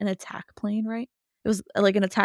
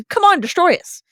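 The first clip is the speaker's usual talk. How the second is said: higher, louder taunt; mocking dare; 10 semitones higher, 6 dB louder than usual. Wu the Wise daring the villain, mock taunt. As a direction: challenge them with scorn.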